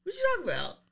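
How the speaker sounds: playing innocent. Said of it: "I said".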